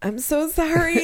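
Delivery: high, drawn-out apology on the edge of laughter; retold laughing apology. Mother apologizing to woman with cancer, helplessly amused. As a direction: imitate apologizing while cracking up. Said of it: "I don't know why I'm laughing".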